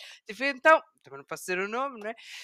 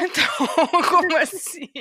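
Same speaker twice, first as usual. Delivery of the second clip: laughing through the question; amused high question, giggling; incredulous laugh; 8 semitones higher, 9 dB louder than usual. Retold or imitated. Retelling own reply to student.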